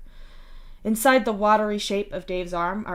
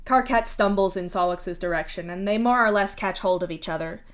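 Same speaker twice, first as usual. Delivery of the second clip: plain reading voice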